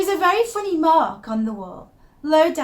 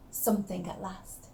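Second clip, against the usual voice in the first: soft, much quieter confiding hush; hushed secret; 6 semitones lower, 11 dB quieter than usual. Acted. Confessing her wallpaper discovery in a secretive hush.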